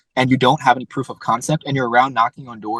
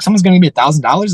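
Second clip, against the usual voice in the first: higher, louder and fast, like a startled newbie; disbelief; 5 semitones higher, 6 dB louder, faster than usual. Retold or imitated. Young landscaper hearing his first big price, surprised and fast. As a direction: blurt it out in happy disbelief.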